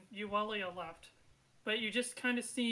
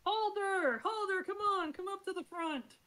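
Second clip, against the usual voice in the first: high, raised call across a crowd; 9 semitones higher, 3 dB louder than usual. Acted. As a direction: summon someone up to the stage. Mayor calls Halder up to front.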